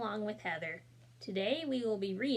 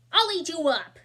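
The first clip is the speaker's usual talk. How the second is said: loud, higher playful growl at mom; mock monster roar; 8 semitones higher, 11 dB louder than usual. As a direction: roar it like a little wild thing. Max yells at his mother.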